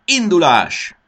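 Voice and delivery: high, loud "go" call; excited full-voice command; shouted start signal. A narrator's reading. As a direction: holler it to launch the race.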